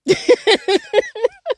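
bright high burst of laughter. Real, not acted. Laughs about being sane.

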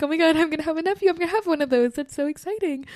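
high, rushed, giddy squeal. Retold or imitated. Aunt-to-be on seeing little boys, giddy. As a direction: gush it all out high and fast. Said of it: "I get so giddy".

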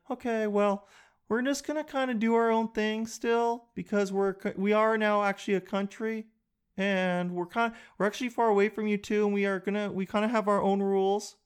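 high, hesitant little voice; meek Canada. Acted. Canada tentatively asking England for freedom, high meek voice. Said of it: "We were just like".

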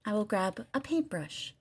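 soft, hushed radio-host murmur; ASMR. Shown as a demonstration. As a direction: talk softly and deliberately into the mic. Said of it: "I can A S M R everybody".

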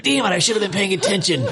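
imitating someone kicking himself; mock frustration. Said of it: "he was like".

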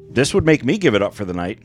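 ordinary talk

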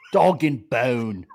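slow, hammed-up Cockney accent; mock Cockney. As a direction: drawl it like a Cockney. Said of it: "at the pubby".

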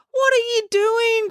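high, slow, aghast cry; horrified cry. Acted. Finn cries out, horrified at his sister's tackle. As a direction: drag it out in shock. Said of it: "cried Finn".

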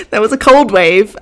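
trying to get it out through giggles; cracking up as she speaks; giggling. Real, not acted. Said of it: "Fucking hell, pull yourself together".

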